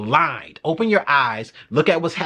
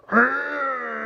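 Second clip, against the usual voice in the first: mock anguished groan; half-crying urgh of dismay; a hammed-up pained whine; 9 semitones higher than usual. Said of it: "I was just like".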